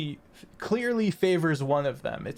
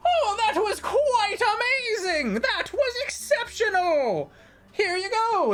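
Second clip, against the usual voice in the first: much higher, gushing, near-tearful delight; excited halfling squeal. Acted. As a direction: squeal with glee over the high score. Halfling cheers the result.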